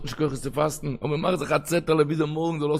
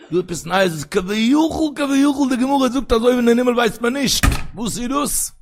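high sing-song with long-drawn words; impassioned preaching; 9 semitones higher, 5 dB louder, much slower than usual. Acted. Rabbi quoting the Gemara to his listeners, impassioned preaching. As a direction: wail it out as a drawn-out chant.

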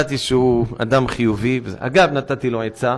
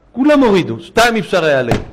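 dramatic; 7 semitones higher, 7 dB louder than usual. Retold or imitated. Retold heavenly verdict, loud.

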